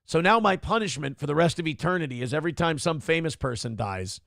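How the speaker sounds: normal talking voice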